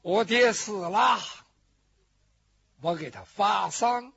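ordinary talk

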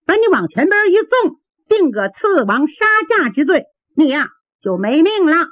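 high, brisk maid's voice demanding answers; a sharp young maid lording it over the prisoner; bossy palace maid. Acted. Maid interrogates the captive.

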